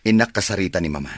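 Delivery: ordinary delivery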